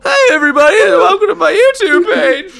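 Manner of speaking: put-on high, loud squeaky teen shout; Jughead voice. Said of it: "That was a good Jughead".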